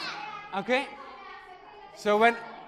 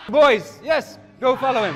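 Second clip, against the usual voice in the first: calling out; 2 semitones higher, 7 dB louder than usual. Real, not acted. Teacher calling the boys.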